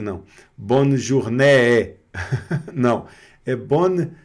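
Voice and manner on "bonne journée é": slow "journée" with a doubled, open "é-é" ending; both Es pronounced. Shown as a demonstration. Teacher jokingly shows the mistake, double e in "journée". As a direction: drag out two loud Es at the end of "journée". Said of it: "você não vai pronunciar os dois E's".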